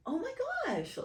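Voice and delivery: very high, gushing astonishment; imitating own emotional squeal; amazed squeal. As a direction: squeal it like you can't believe your luck. Retold amazement when girl returns.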